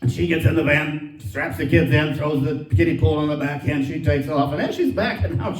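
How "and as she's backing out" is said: ordinary talk, nothing marked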